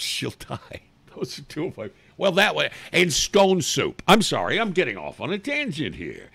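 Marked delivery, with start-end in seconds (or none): none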